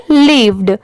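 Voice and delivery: lived with the last d fully released; final d sounded. Shown as a demonstration. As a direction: say lived as "leev-d", ending on a clear d. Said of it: "ड की sound जरूर आएगी".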